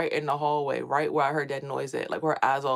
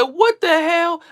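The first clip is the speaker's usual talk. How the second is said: high, loud, slow frightened yell; mother seeing her son rise from bed, high loud alarmed shout; 13 semitones higher, 10 dB louder, much slower than usual. Retold or imitated. Sees son rising from bed.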